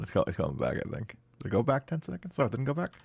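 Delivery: normal talking voice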